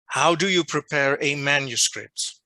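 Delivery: ordinary delivery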